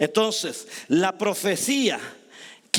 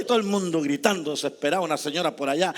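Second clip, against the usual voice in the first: normal talking voice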